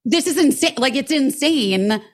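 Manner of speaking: high, exasperated retold outburst; retold anger. Customer fuming at rental clerk over refusal, retold angry. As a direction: act out indignant disbelief, pitched high. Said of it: "I got fucking angry".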